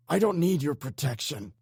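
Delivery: high, loud furious shout; screaming in rage. A narrator's reading. Captive man screaming in rage at the gang leader. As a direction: spit defiance at him. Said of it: "the man screamed in rage".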